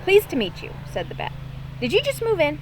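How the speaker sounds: bubbly friendly neighbour; cheerful bat. Acted. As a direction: bat greeting the new neighbour. Bat voiced higher and louder.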